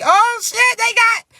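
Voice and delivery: high, loud burst of mock amazement; acted excitement. Newcomer stepping through the portal into a good world, excited. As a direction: burst out as if thrilled by a discovery. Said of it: "you excited".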